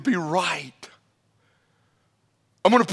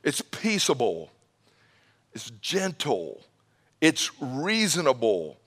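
dropping soft; 6 semitones lower, 5 dB quieter than usual. Acted. Preacher reading virtues of wisdom.

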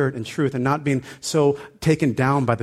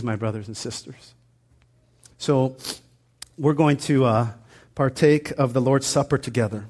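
ordinary talk, nothing marked

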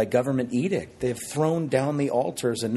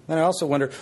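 normal talking voice

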